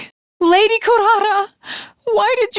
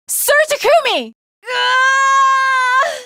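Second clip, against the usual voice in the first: panicked shout; 8 semitones higher, much slower than usual. Acted. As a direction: yell for him in alarm. Calling out in danger.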